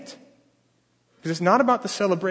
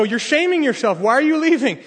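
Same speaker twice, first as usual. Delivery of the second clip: higher, louder scolding crowd; scandalized guests; 8 semitones higher, 6 dB louder than usual. Retold or imitated. Village guests scolding the father for leaving, loud and scandalized. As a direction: scold him for shaming himself.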